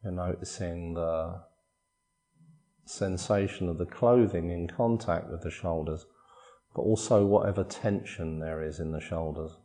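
soft, unhurried body-scan voice with long pauses; guided meditation. Acted. Meditation teacher guiding listeners through a body scan, calm guided meditation. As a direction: calmly guide them through relaxing, phrase by phrase.

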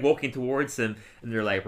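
ordinary talk

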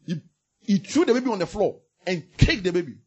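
normal talking voice

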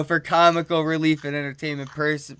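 usual speaking voice

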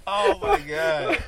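slow, high, loud stretched gasp; real, slow, high cry of disbelief; drawn-out disbelief. Real, not acted. Reaction to embarrassing story.

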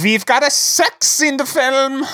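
put-on German accent. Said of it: "with German accents in mind".

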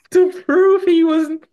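words squeezed out through laughter; giggling high while telling it; laughing. Real, not acted. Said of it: "laughing while telling".

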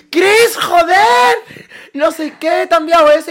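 slow, very high, loud panicked cry; panicked shout. Retold or imitated. Calling out to her friend Cris about the photo, high panicked shout. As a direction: shriek at your friend in alarm.

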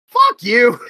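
loud, scornful retort; mock defiance. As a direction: spit it out at the rejection. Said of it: "I was like".

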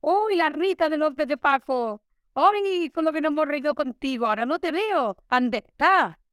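high, slow gushing fan voice. Retold or imitated. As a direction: coo it like a lady who has spotted her favourite actress. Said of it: "te siga diciendo". (admiradora entusiasmada, voz aguda y lenta)

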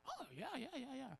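high, quiet eager "oh yeah"s; mimicking eager crowd. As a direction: play the crowd that thinks it knows it all.

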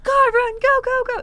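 very high, loud urging to run; frantic yell. Retold or imitated. Date yelling to flee.

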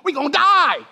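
high, loud comic yell of alarm; panicked shout. Acted. Preacher acting real disciples waking Jesus, panicked shout. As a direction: scream it as a terrified disciple, hammed up. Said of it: "These were real human beings".

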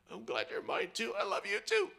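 put-on an old dad choked up on the phone; crackly old dad voice. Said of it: "this crackly voice says".

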